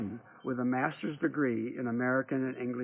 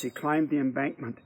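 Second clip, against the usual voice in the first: ordinary delivery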